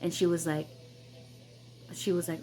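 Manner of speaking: ordinary talk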